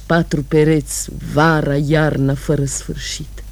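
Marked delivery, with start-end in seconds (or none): 2.3-3.4 quiet fading refrain. Acted. Repeated refrain, second take, fading.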